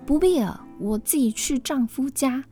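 arrogant. Acted. Proud sister refusing her father's escort, haughty. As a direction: toss it off as if nobody matters. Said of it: "非常傲慢地说".

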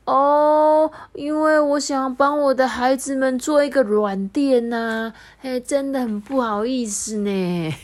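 sheepish explaining creature; mild sheepish voice; lightly voiced apologetic bird. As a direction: apologize a bit embarrassed. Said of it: "阿水就说".

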